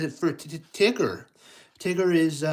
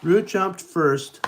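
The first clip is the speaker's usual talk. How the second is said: ordinary delivery, nothing marked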